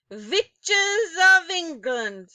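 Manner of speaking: very loud, high, slow commanding witch cry; Grand High Witch summoning her audience; witch shout. A narrator's reading. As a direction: bellow like a ruling witch queen. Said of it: "shouted the Grand High Witch".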